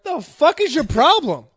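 mock confrontation. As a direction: act out snapping at a stranger. Snapping back at someone yelling.

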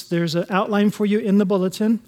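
ordinary talk

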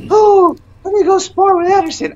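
high-pitched, loud imitation of eager gym mates; mock eagerness. Gym mates keen to spar with Anderson, mock eager. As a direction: put on an over-keen, high squeal of a voice. Acting out everybody's eager reaction.

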